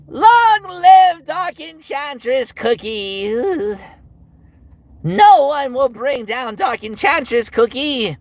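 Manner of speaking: high, slow, drawn-out villain minion; Licorice Cookie. Acted. Devoted minion hailing Dark Enchantress, high and drawn-out. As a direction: proclaim it shrilly, stretching every word. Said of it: "my impression of Licorice".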